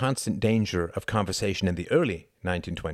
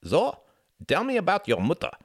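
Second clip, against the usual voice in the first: higher-pitched imitation German accent; 12 semitones higher, 4 dB louder than usual. Hart teasingly asks Tom about his mother, German accent. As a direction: put on a mock German analyst's accent. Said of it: "imitating a German accent".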